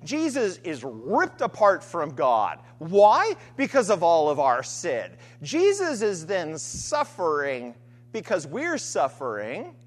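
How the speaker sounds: normal talking voice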